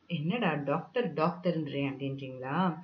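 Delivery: low, soft imagined listener's question; quiet aside. Acted. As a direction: drop right down for a puzzled aside. Voices the listeners' puzzled question.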